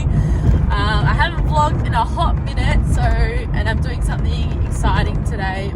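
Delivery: talking while the car bounces; on a bumpy road. Real, not acted. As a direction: voice jolted by road bumps. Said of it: "sorry about the bumps in the road".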